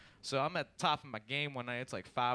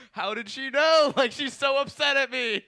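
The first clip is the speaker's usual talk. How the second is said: loud, high, stretched-out disbelief; hammed-up high yell of dismay; mock panicked outburst; 12 semitones higher, 12 dB louder than usual. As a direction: wail it in comic panic. Acts out panicked reaction to mom.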